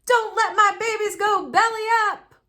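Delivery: high, loud howl; Daddy howling at the sinking plants. A narrator's reading. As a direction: wail it out in despair. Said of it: "howled Daddy".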